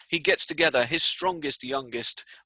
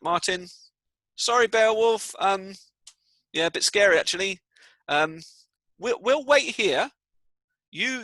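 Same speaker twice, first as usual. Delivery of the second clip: higher, louder nervous pleading; scared soldiers; 6 semitones higher, 4 dB louder than usual. Acted. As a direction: make excuses to the king. Cowardly soldiers refuse to fight.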